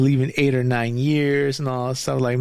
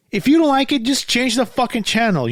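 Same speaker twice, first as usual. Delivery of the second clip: real angry outburst; 9 semitones higher than usual. Real, not acted. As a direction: blast it out, fed up and furious. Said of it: "I am angry".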